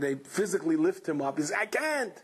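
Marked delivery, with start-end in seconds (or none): 1.6-2.1 high, drawn-out protest; pained reluctance. Acted. Patient refusing the dancers, high pained protest. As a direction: plead you can't, slowly.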